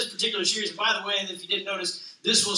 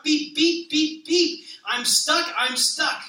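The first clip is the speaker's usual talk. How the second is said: higher, lightly voiced call for help; stuck little truck; 5 semitones higher, slower than usual. Little blue truck calling for help in the mud, lightly voiced higher cry.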